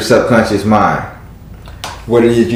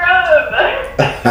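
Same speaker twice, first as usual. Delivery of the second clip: breathy high-pitched giggle; high laugh. Real, not acted. Laughs after the Trevor voice.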